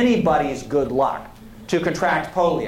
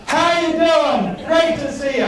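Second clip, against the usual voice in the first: much higher and louder, a big hearty radio-host greeting; booming AM radio voice; 10 semitones higher, 3 dB louder than usual. Retold or imitated. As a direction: boom it out like an old-school AM host. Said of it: "that's an A M voice".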